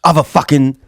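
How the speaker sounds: normal talking voice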